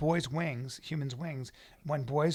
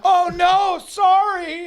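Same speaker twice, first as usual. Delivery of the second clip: high, loud fake "sorry" yell; mock apology shout; 15 dB louder, much slower than usual. Mock apology yelled at drivers.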